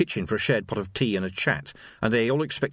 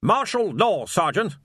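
snapped; 9 semitones higher, 4 dB louder than usual. A narrator's reading. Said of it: "snapped Rust".

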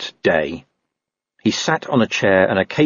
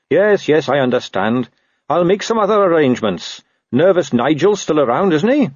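higher-pitched, agreeable watchman; lightly voiced conceding Carrot; 7 semitones higher than usual. Watchman baiting the dog with a rival, lightly agreeable. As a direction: give in with sly reasonableness.

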